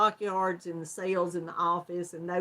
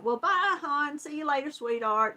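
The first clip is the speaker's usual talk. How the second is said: high, sugary farewell, higher than her usual voice; sweet goodbye; 5 semitones higher than usual. Retold or imitated. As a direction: call him hon like a fond Southern mama. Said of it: "and I was like".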